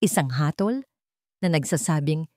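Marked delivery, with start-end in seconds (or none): none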